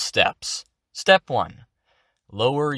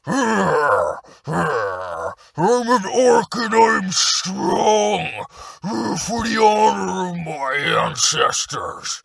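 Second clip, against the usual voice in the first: much higher, loud, very slow orc boast; 9 semitones higher, 4 dB louder, much slower than usual. Shown as a demonstration. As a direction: push it out from the chest like a proud orc. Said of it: "push out with your chest".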